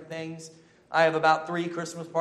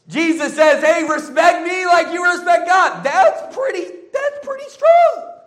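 excited shout; 12 semitones higher, 11 dB louder than usual. Acted. Laughing, shouting Jesus's claim.

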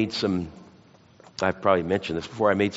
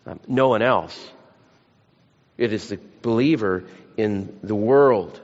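ordinary talk

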